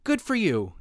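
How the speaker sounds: high, bright-eyed cheer under the breath; root for him silently like a proud dad; mock cheerleader encouragement; cheering inner voice. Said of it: "inner cheerleader for Charlie was like".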